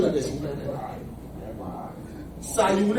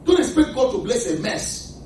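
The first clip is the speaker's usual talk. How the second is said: ordinary delivery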